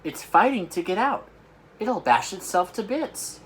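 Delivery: high, loud anxious cry; alarmed cry. Acted. Sophie frets over the dream in the jar, alarmed. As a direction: worry aloud for the thrashing dream. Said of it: "Sophie cried".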